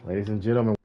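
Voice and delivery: ordinary talk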